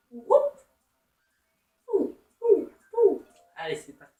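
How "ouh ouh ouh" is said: 1 high hoots. Real, not acted. Hooting at the game.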